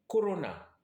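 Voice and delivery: "Corona" with a short O and an A; Yai corona. Shown as a demonstration. Teacher showing the Yai word "Corona", short O. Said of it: "les sons voyelles, vous entendez O".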